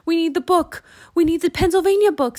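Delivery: loud, high, hurried demand; frantic urgency. A narrator's reading. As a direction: panic while hunting for something. Frantic search during dinosaur attack.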